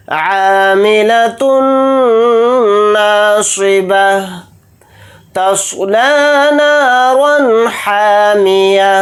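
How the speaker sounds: slow melodic tajweed chant; measured Quranic recitation. Shown as a demonstration. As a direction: recite slowly, stretching each vowel. Continues recitation.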